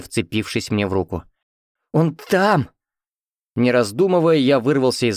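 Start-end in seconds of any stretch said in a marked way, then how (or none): 1.9-2.7 high, stuttered urgent cry; stammering cry. A narrator's reading. Mother-in-law clutching the rescuer's arm, high stammering cry. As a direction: stammer it out in panic. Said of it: "Заикаясь, закричала будущая тёща".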